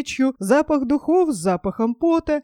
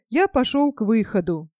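ordinary delivery

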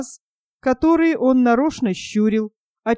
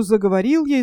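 plain reading voice

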